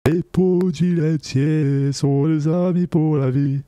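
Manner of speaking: slow robotic autotuned singing; autotune. Shown as a demonstration. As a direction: sing it through autotune. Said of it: "je vais mettre un peu l'autotune".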